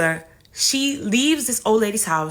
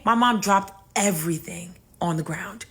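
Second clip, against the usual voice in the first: ordinary talk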